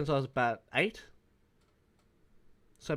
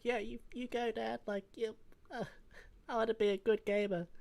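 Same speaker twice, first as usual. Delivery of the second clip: put-on childlike cheering; 8 semitones higher, 7 dB quieter than usual. His child self cheering on dad.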